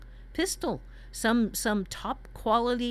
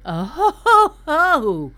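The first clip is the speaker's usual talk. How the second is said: loud, high laughing "oh ho ho"; laughing exclamation; 6 semitones higher, 12 dB louder, slower than usual. Real, not acted. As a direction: burst out in delighted laughter. Reacting to partner's plague prompt.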